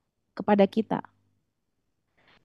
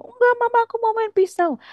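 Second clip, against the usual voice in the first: put-on very high, squealing little kid; hammed-up toddler tantrum, pitched way up; mock crying child; 14 semitones higher, 3 dB louder, faster than usual. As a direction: imitate a child's shrill protest. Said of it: "anak Anda makin nangis".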